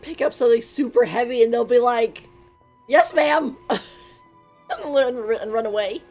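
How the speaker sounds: usual speaking voice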